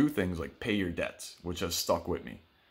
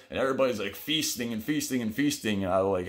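normal talking voice

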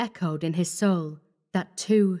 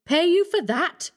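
much higher, aghast question; high, indignant outcry; incredulous cry; 10 semitones higher, 4 dB louder than usual. A narrator's reading. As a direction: throw the demand back in disbelief. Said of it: "he cried".

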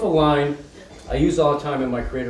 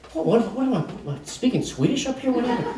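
baffled, puzzled; 7 semitones higher, 4 dB quieter than usual. Retold or imitated. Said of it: "retold inner thought".

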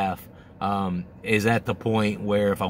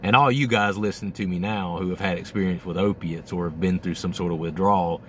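ordinary talk

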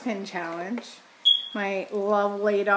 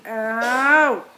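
sharp yelp; 7 dB louder than usual. Real, not acted. Clothespin pinches face.

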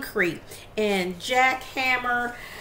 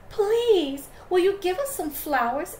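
high, pleading little wolf; pleading little wolves; 10 semitones higher than usual. Acted. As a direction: beg sweetly in a high voice. Wolves asking flamingo.

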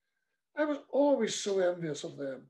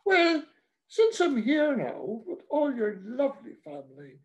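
put-on higher, chirpy old priest; delighted priest; 7 semitones higher, 4 dB louder than usual. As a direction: beam and accept the tea. Priest voiced in story.

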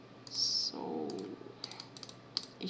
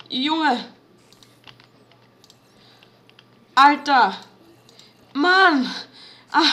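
loud high stunned exclaiming; 5 semitones higher, 12 dB louder, much slower than usual. Real, not acted. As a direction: blurt each out, shaken.

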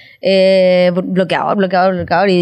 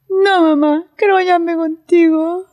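put-on lightly higher, pleading kid voice; a hint of an insistent child; 6 semitones higher, slower than usual. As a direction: plead like a promised little girl. Daughter insisting on the bath. (leve voz de niña insistente)

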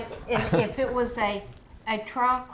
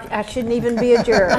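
laughing; 10 dB louder, faster than usual. Real, not acted. Laughing protest at a joke.

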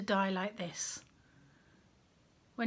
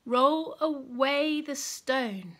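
slow firm command; 3 semitones higher, 4 dB louder, much slower than usual. A narrator's reading. Jesus repeats his command.

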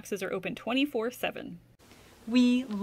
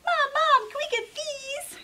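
much higher, eager begging voice; pleading little rabbit; 3 dB louder than usual. Acted. Jasper pleads with Mom at the checkout, high and begging. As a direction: beg like a small kid tugging a sleeve. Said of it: "Jasper pleaded".

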